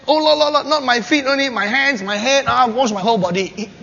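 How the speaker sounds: high, loud, gushing excitement; overeager Peter. Acted. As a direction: Peter overdoing it in a rush.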